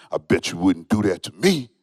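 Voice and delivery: low mumbled grumble from a big man; a hint of a big guy grumbling low; big man at the checkout muttering a low threat. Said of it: "I hear him mumble".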